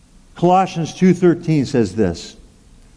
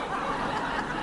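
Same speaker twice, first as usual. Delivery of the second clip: soft chuckle; 16 dB quieter than usual. Real, not acted. Laughs at his own punchline.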